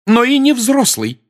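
very high, loud, drawn-out comeback; heated retort. A narrator's reading. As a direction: hurl it back high and slow. Mrs Weasley's heated retort. (запальчиво, высоким голосом)

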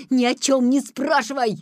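high, loud urgent orders; urgent shouting. A narrator's reading. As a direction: shout with all your might. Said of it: "закричал изо всех сил".

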